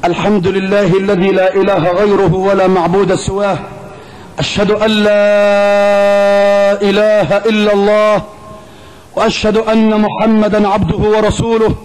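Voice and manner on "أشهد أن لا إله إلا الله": ordinary talk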